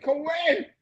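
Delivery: high disbelieving echo of the word; incredulous retold question. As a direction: squeak out the shock. Disbelief at co-ed shower.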